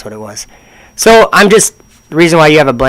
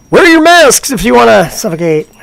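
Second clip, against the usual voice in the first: high, sarcastic parody of a public notice; mock announcement; 10 semitones higher, 3 dB louder than usual. Mocking the mask rules in a high sarcastic voice. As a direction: sneer it like a fake safety message.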